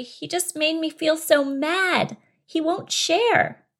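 high, slow whine; complaining bunny. Acted. Bunny blaming mouse to fairy, high complaining voice. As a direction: complain slowly in a high little voice.

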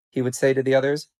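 plain reading voice

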